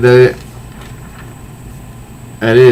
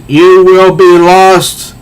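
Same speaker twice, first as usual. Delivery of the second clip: slow, high, loud creature threat; menacing creature; 5 semitones higher, 4 dB louder, much slower than usual. Acted. Cat creature threatening Wonder Woman, slow and menacing. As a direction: draw it out like a menacing beast.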